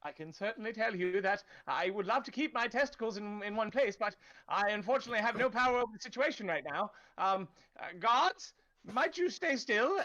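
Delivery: nervous town official. Acted. Cornered town official stammers excuses to the knife-wielding player, high and nervous. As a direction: fumble excuses like a cornered bureaucrat.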